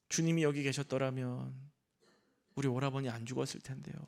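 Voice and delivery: low, soft, sorrowful quote; imitated tearful lament. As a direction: lightly voice a grieving woman's complaint, hushed. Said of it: "울어요".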